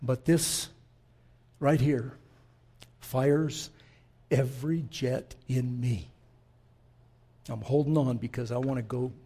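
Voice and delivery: quiet, choked-up voice, holding it in; fighting not to get emotional over the verse; holding back tears. Real, not acted. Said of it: "not to get emotional".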